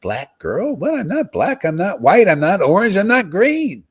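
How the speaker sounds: high, loud, rising burst of realization; mock awakening. Voicing River's awakening to her follower, in a high, loud burst. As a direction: cry it out as a sudden revelation. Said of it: "and you're like".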